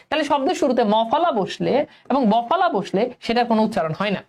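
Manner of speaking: ordinary talk, nothing marked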